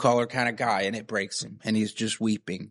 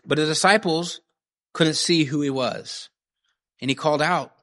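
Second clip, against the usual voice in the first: ordinary delivery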